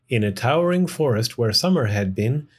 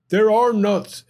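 higher and louder burst; exclaiming; 7 semitones higher, 4 dB louder than usual. A narrator's reading. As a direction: blurt it out. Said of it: "exclaimed Bruce".

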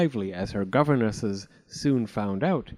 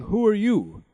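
high, louder king's question; king asking again; 8 semitones higher, 5 dB louder than usual. A narrator's reading. King asking the old fairy who she is, higher and louder. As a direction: press the stranger for her name. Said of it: "said the king".

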